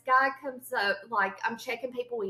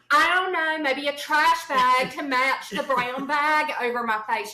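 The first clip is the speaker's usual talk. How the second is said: raised, loud comic whine; exasperated outburst; 2 semitones higher, 5 dB louder than usual. Retold or imitated. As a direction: wail it in mock despair. Retells her exasperated answer to friend.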